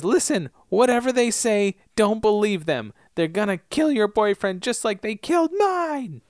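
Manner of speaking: high, frantic warning building to a wail; mock hysterical. Retelling her warning about the boyfriend, mock hysterical and unhinged. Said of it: "she's kind of hysterical".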